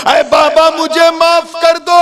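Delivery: high, loud begging cry; loud desperate begging; anguished plea. Acted. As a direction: cry out to the old man for mercy. Youth begs the old man.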